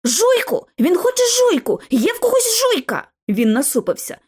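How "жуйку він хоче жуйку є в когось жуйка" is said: excited shout. Acted. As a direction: blurt it out, jumping up in a rush. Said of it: "підскочив з криком".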